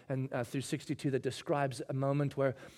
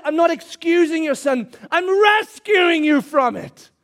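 impassioned declaration; 12 semitones higher, 12 dB louder than usual. Acted. Jesus voiced, preacher at full force.

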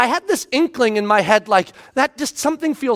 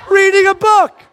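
higher, louder indignant retelling; dad outraged she was just reading; exasperated; 9 semitones higher, 4 dB louder than usual. Retold or imitated. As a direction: stress the absurdity loudly.

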